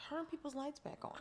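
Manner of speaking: tiny, hushed mock request; hushed plea. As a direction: murmur it under your breath. First take of her reaction quote.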